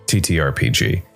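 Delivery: plain reading voice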